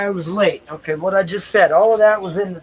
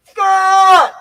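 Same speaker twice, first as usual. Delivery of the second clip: a loud, high, far-carrying yell; shouting a name; 12 semitones higher, 12 dB louder than usual. Shown as a demonstration. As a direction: call out as loud as you can. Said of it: "Like that".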